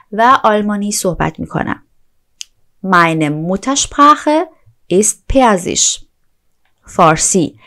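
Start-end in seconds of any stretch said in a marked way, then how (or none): 2.9-6.0 slow, careful German; slow model sentence. Shown as a demonstration. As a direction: drag it out for learners. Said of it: "slow German model".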